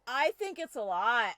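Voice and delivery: loud, emphatic agreement; loud emphatic reply. Real, not acted. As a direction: answer a co-player with a big burst. Laughing reply about character's anger.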